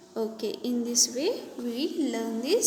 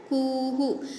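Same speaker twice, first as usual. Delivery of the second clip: ordinary delivery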